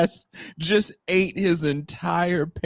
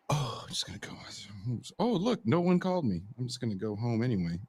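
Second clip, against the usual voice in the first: mock nervous mumble; 4 semitones lower, 10 dB quieter, faster than usual. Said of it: "I'd be like".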